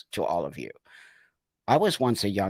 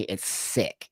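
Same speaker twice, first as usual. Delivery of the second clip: disgusted whisper; 4 semitones lower, 7 dB quieter than usual. Real, not acted. Disgusted at suicide argument.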